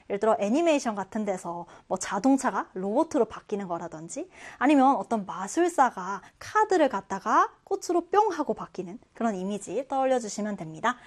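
ordinary talk

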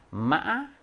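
마 broken in the middle, then rising. Shown as a demonstration. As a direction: demonstrate Vietnamese 마 with a catch and upward jump. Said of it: "꺾여 중간이 끊어져 있어".

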